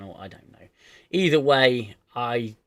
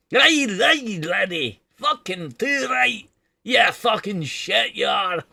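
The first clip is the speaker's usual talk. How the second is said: very high, loud Scottish comic shouting; hammed-up sweary Scottish yell, drawn out; bad Billy Connolly; 10 semitones higher, 9 dB louder, slower than usual. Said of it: "Sound like Billy Connolly, but bad".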